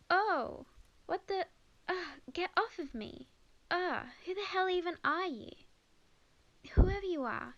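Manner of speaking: shocked and angry at being grabbed; startled shout. Acted. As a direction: jump out of your skin and snap back. Grabbed suddenly, startled and indignant.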